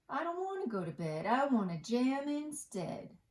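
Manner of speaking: eager high platypus, rushing; bouncy platypus who wants to jam; high, fast platypus. Acted. Gus refuses bed.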